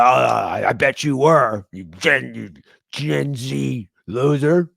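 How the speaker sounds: mock angry commenter, drawn-out blustering; barking online troll. Comedian mimicking Facebook arguers, barking insults. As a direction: rant like a yapping dog in a comment fight. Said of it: "That's how I picture everybody. You're like fucking barking dogs".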